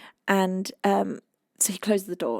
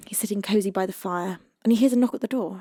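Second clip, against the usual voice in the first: normal talking voice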